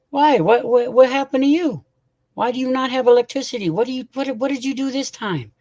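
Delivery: high, incredulous string of questions; baffled outcry. Real, not acted. To a friend who lost power, high disbelieving questions. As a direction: pitch up in disbelief at the outage.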